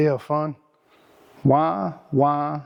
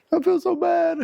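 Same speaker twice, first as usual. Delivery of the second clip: a much higher, whiny mock wail; hammed-up wail; 11 semitones higher than usual. Mocking a friend who just lost his job, high hammed-up whine. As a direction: overplay the self-pity like a whining kid.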